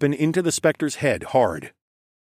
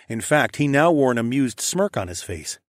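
ordinary delivery

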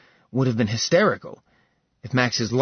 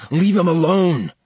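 forceful order; 6 semitones higher, 5 dB louder than usual. Acted. Max shielding his brother.

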